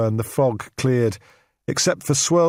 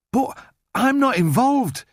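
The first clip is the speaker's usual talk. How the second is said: high, slow, rattled protest; losing composure, voice climbing; a man losing his grip; control slipping; 12 semitones higher, slower than usual. A narrator's reading. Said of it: "his control suddenly slipping".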